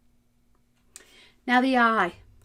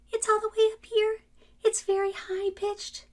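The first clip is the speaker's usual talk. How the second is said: put-on child's high pitch; 13 semitones higher than usual. Shown as a demonstration. Teacher showing a child's pitch, very high. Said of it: "What is the pitch of a young child?".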